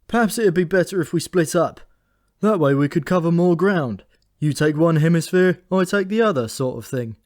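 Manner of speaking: put-on grumpy old bird thinking aloud; weary raven Memory.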